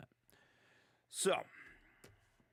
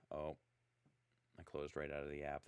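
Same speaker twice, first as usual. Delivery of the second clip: normal talking voice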